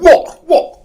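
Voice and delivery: high, loud shouted "What? What?". Retold or imitated. Squaring up to the lads outside the shop, shouting. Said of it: "I'm going".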